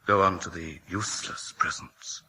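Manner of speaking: hushed, breathy stage whisper; whispered aside. A narrator's reading. Storyteller nudging himself along, in a whisper. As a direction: murmur it like a secret aside.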